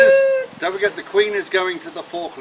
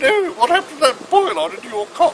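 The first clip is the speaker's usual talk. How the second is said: high, loud mock-royal query; regal Queen; 8 semitones higher, 6 dB louder than usual. The Queen questions the patient at the punchline, in a high loud regal voice. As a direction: ask it in a hammed-up high Queen voice. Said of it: "more regal".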